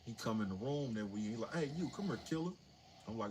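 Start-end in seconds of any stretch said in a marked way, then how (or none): 1.5-2.5 higher, mock gruff guard call. Guard calling new inmate over, mock gruff.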